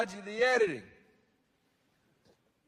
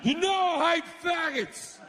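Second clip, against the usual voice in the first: higher angry shout; furious God; 9 semitones higher than usual. Acted. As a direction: snap out the outburst. God erupts at a party.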